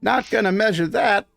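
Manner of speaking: pleased with his work, hand it over; cheerful shopkeeper. Acted. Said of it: "He goes".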